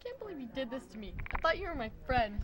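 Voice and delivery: soft, hurt first reading of the line; quiet first take. Acted. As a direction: say it softly, almost to yourself. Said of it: "let's do it again".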